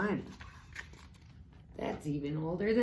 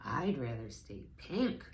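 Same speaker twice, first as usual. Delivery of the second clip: lower piglet voice; 6 semitones lower than usual. A narrator's reading. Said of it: "cried the piglet".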